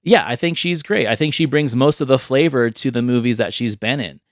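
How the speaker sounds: normal talking voice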